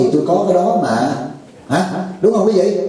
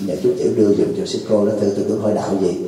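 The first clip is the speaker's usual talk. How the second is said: put-on low, soft, stammering shy young man; mock bashful suitor asking the novice to pass on a letter; timid suitor; 7 semitones lower, 5 dB quieter, faster than usual. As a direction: mumble the request nervously, stuck on nhờ. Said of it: "ổng đưa cái lá thư nói".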